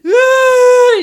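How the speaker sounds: high, loud wailing cry; awful cry. Retold or imitated. Kid bursting into tears over a mistake, loud high wail. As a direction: let out a sudden awful wail. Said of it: "I would just be like".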